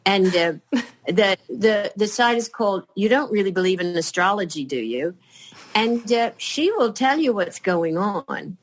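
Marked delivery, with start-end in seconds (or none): none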